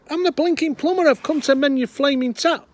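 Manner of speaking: high, very loud angry shout; furious plumber. Acted. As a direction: yell through the door, temper gone. Plumber loses temper at door.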